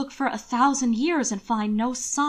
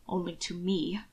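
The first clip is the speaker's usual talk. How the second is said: muttered aside, softer; under his breath; 2 semitones lower, 6 dB quieter than usual. Acted. Hannibal mutters to his cousin under his breath. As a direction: says it half to himself. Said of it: "said beneath his breath".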